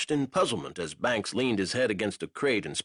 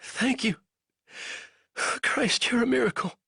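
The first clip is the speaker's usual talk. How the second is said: near tears; 14 semitones higher than usual. A narrator's reading. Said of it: "on the verge of tears".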